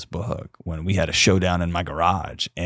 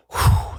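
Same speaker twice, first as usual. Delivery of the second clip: relieved exhale. Shown as a demonstration. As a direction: let the breath go, at home and safe. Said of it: "that exhale".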